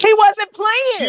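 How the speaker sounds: "He wasn't playing" drawn out high; slow, high, amused emphasis on "He wasn't playing"; emphatic agreement. Real, not acted. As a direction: stretch every word of "He wasn't playing", pitch way up. Emphatic agreement with listener's remark.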